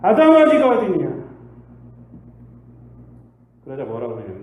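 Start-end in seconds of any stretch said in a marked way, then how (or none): 0.0-1.2 loud, high call; God calling for the hiding Adam. Acted. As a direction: call Adam's name out loud. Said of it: "이렇게 물어봐요".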